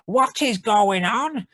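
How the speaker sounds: high, slow, put-on girl's exclamation; exclaiming girl. Cardigan girl turns and exclaims at the chaos, high and drawn out. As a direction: cry out in a high young woman's voice. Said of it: "she exclaims".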